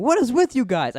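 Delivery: high, loud protest; retold exasperation. Boy on phone to his unhelpful father, loud exasperation.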